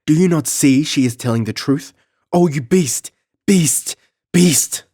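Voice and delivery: very high, loud furious screams; frantic fury. Acted. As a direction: hurl it at him, beside herself. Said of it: "almost beside herself".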